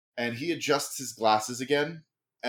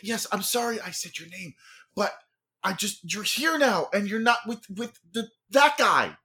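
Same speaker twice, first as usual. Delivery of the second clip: eager brother; 7 semitones higher than usual. Acted.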